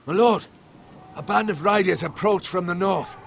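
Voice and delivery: high, urgent lookout's report; urgent warning. Acted. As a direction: alert your lord to approaching danger. Warns lord of approaching riders.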